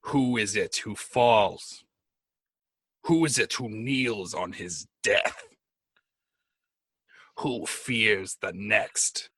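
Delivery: slow, drawn-out questions with long pauses; ominous voice in the mind. Acted. Dark god messaging a man inside his head, ominous and slow. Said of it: "I'm gonna whisper to him".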